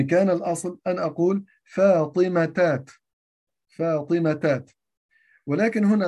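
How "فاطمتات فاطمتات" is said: فاطمتات slowed, each syllable clear; wrong form spelled out. Shown as a demonstration. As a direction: show the wrong plural deliberately. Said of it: "لا أقول شجرتات". (نطق فاطمتات ببطء كصيغة خاطئة)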